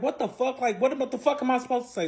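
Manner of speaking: high, fast indignant retort; mimicking his outburst. Fiance snapping back during their cruise argument, high indignant mimic. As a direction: throw it back at her, fed up.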